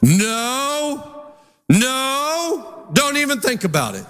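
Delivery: rising, high, firm no no no; stern warning. Retold or imitated. Preacher warning his greedy dog off the food, firm. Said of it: "immediately I'm like".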